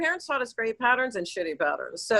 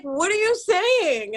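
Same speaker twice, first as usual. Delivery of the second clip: baffled question; 7 semitones higher, much slower than usual. Retold or imitated. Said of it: "they were like".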